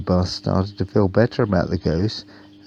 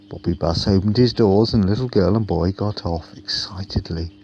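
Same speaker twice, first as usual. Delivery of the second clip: ordinary delivery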